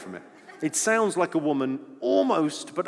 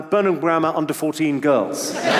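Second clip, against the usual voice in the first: ordinary talk, nothing marked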